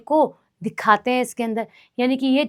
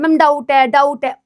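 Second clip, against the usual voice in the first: mock student; 3 semitones higher, 7 dB louder than usual. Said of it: "एक बच्चा है जो लगातार लिख रहा है".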